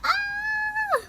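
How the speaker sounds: very high squeal; sudden excited shriek; fangirl squeal. Real, not acted. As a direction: squeal with delight. Reacts to photo.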